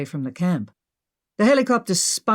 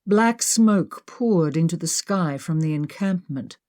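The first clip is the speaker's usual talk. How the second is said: usual speaking voice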